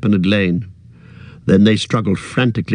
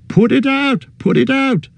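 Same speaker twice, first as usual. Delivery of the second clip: high, frantic begging; trapped hobbit begging his friends. A narrator's reading. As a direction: beg them desperately, pitched high. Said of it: "begged Merry".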